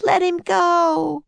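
high, slow pleading scream. A narrator's reading. Annie begging them to release him, screaming. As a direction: stretch the plea out high. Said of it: "screamed Annie".

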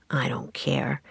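low, soft sulky mutter. A narrator's reading. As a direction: mumble it sullenly under your breath. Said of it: "he muttered".

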